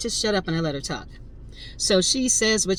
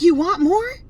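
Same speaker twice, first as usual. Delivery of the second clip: higher, louder, mocking challenge; taunting; 12 semitones higher, 7 dB louder than usual. Driver taunting Karen at her car window, mocking challenge. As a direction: egg her on with a sneer.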